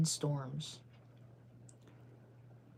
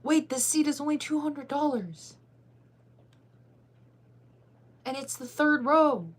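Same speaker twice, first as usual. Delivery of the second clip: excited gasp; 8 semitones higher, 6 dB louder, slower than usual. Real, not acted. Finds cheap front-row seat.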